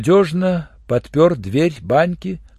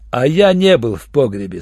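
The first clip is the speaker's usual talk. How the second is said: ordinary delivery, nothing marked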